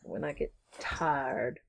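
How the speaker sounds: slow, soft, low Texan drawl; weary Texan twang, low and slow; Texan accent. Shown as a demonstration. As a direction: draw it out like a tired Texan. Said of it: "that Texan accent comes out".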